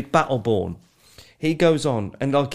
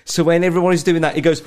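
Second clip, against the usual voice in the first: ordinary talk, nothing marked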